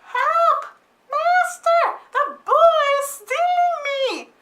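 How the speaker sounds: very high, loud screaming cry for help; harp yells. Acted. Magic harp shrieks to the giant for help. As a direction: shriek for your master to save you.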